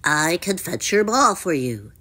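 helpful froggy voice; croaky, obliging creature; croaking frog. A narrator's reading. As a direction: offer a favour in a frog's croak. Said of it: "he croaked".

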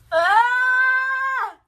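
sharp high loud cry of disgust. Real, not acted. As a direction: let out a loud yelp. Gagging reaction.

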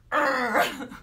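a loud, high groan of frustration; frustrated groan. Acted. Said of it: "you can see I get worked up".

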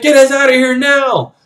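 high, loud retold command; frantic yelled order. Villain ordering the ship to leave, frantic yell. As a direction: act out a desperate order to take off.

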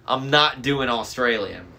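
usual speaking voice

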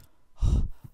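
a sudden startled gasp; sharp gasp. Real, not acted. Gasping after a close call with the monster. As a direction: gasp in fright.

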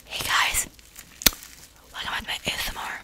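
soft, breathy whisper intro; mock ASMR whisper. Girl teasing her sister with a mock ASMR whisper. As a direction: whisper it like an ASMR channel host. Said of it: "I'm already cringing so hard at you".

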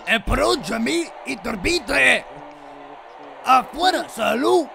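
put-on Mexican accent. Streamer announcing Iturbide to friends, mock Mexican accent. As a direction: shout it like an angry Mexican announcer. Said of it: "narrate in, like, Mexican, um, accent".

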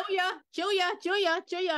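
high, loud repeated calls for Julia; calling out. Acted. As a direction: call her over, excited. Calling friend to come look.

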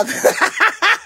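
high, loud cackle; carcajada. Real, not acted. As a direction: break into a shrieking, rising laugh. Cackles at being called thinner. (carcajada aguda y fuerte)

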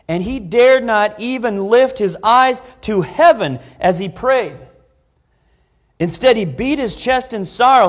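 loud, high, booming scripture reading; fiery preacher reading scripture aloud; preaching at full force. Acted. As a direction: proclaim the verse to the whole hall.

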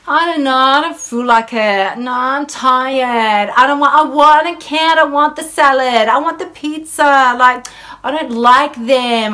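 put-on high, whining, childish complaints; whiny voice. Her inner child refusing food choices, whiny and high. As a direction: whine like a sulky kid. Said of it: "hear my whiny voice".

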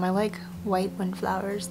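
ordinary talk, nothing marked

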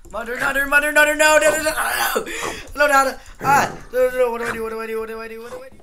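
high, loud panicked yelling; frantic, nearly laughing in panic. Real, not acted. Panicking in a fight.

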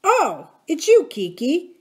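high, drawn-out recognition; jolt, then recognise a friend; startled. A narrator's reading. Jack made to jump by Kiki landing on him, startled. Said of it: "made him jump".

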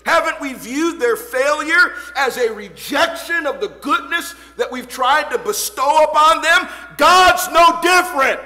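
high, loud preaching climax; preaching at full force. Acted. Preacher comparing parents with God, loud. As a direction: build to the climax.

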